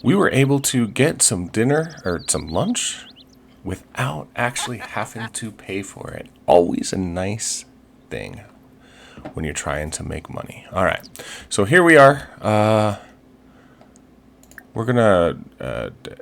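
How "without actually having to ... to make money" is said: ordinary talk, nothing marked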